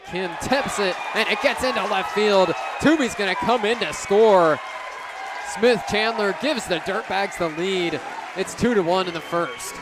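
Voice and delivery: rising to a loud, high, thrilled call as the run scores; excited home-run call. Real, not acted. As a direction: let it burst out, the go-ahead run is in. Big play scores the go-ahead run.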